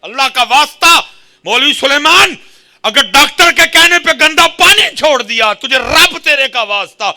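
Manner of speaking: loud, high-pitched exhortation; full-force preaching. Real, not acted. Preacher warns the congregation about unclean earnings, impassioned shout. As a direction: thunder the warning at the congregation.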